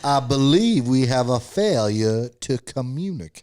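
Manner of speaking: put-on southern accent. Quoting to show friends a southern drawl, put-on accent. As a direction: draw it out in a hammed-up southern accent. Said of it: "now you're getting there".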